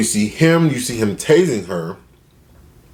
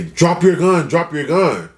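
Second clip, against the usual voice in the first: higher-pitched, lightly acted police yell; mock urgent command; 7 semitones higher, slower than usual. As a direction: imitate an officer calling out a warning. Retelling officer's urgent command.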